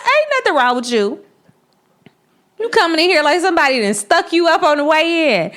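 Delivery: high, loud, fast incredulous scold; mock exasperated father; blunt stepdad. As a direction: imitate the stepdad brushing it off.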